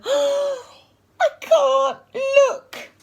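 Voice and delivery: slow, high, drawn-out wail; feigned horror. A narrator's reading. Henry peeking from the window, horrified wail.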